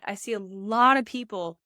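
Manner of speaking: ordinary delivery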